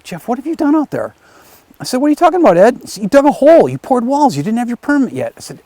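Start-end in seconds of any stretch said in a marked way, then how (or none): 0.0-1.1 high-pitched, imitated alarm at what was done; retold voice of a dismayed official confronting someone; alarmed reproach. County official calls builder about unpermitted work, mock alarm. 2.0-2.8 high, louder retold protest; defensive disbelief. Builder answers the accusing official, high-pitched mock bafflement. 3.0-5.3 high-pitched retold scolding, loudest at the start; agitated accusation. Official scolds builder for digging without permit, high mock outrage.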